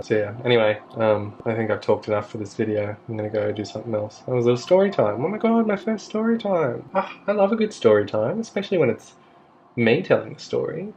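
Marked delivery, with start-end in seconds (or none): 5.2-10.9 higher, delighted gush; excited. Real, not acted. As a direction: gush about your first story time. Delighted at first story time.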